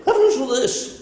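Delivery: high, urgent radio host call; Alan Jones impression. Shock jock urging listeners, high excited call. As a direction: squeal it out like a worked-up talkback host. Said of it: "Mister Alan Jones".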